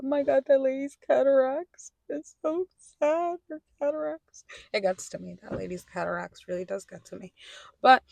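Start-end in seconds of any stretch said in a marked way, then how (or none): 0.0-4.4 high, hammed-up weepy voice; mock sobbing. Vlogger mocking herself crying at a commercial, hammed-up weepy. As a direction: pretend to be moved to tears by a sad ad. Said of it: "I'll just sob myself out".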